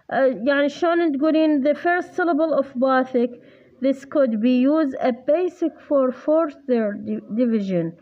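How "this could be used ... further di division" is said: very slow, carefully read English; slow reading aloud. Shown as a demonstration. Tutor reading a textbook sentence to the student. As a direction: read each word like dictation. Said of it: "reads textbook line slowly".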